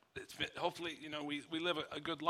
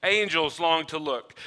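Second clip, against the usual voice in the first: normal talking voice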